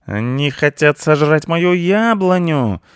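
high, loud, drawn-out cat wail; yelling. A narrator's reading. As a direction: howl it out in outrage. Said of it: "завопил Рыжопиус". (возмущённый протяжный вопль)

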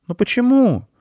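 put-on very high-pitched child's question; little boy, voice shooting up; protesting boy. A narrator's reading. Boy protests the wolf's refusal.

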